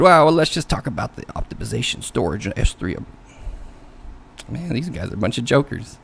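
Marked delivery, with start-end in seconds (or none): 0.0-3.0 mock voice of a colleague butting in; imitating an interrupting interviewer; imitated interrupter. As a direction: butt in with a put-on voice and change the subject. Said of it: "Then some other guy interrupted".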